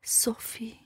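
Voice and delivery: barely audible, breathless whisper; faint whisper. A narrator's reading. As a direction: whisper it like she's fading. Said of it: "زیر لب گفت".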